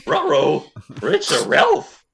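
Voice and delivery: loud, high cartoon voice; hammed-up "ruh-roh" in a goofy dog voice; cartoon dog catchphrase. Game master joking after a weapon hit, hammed-up cartoon voice.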